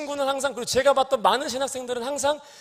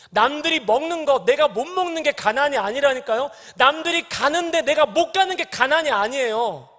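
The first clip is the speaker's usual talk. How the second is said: full-force preaching; 5 semitones higher, 6 dB louder than usual. Acted. Preacher telling the congregation what poverty is, in a loud, rising preaching voice. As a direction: drive the point home from the pulpit.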